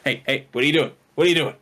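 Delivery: scolding call-out, rising in pitch; real interrupted scolding; yelling at a pet. Real, not acted. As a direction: call out sharply to stop mischief.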